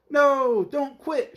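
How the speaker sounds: high, loud pleading shout; the crowd begging Amelia not to quit. Acted. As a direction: beg her to stay in the race. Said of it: "shouted the crowd".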